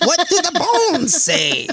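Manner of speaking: very high, slow, laughing voice; hammy mystic reading bones; mock shaman voice.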